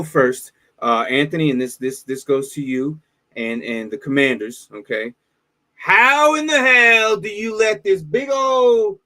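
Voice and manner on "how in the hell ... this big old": exasperated rant. Real, not acted. Venting at the Commanders' defense.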